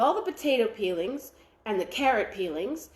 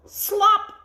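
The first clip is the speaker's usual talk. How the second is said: high, loud splash word; loud splat of the slop landing; slop sound word; 9 semitones higher, 8 dB louder than usual. A narrator's reading. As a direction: fling it out like a splat.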